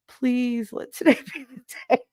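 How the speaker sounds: high, mock-desperate begging with a laugh; desperate plea. Pregnant woman waking up hoping to deliver, laughing plea.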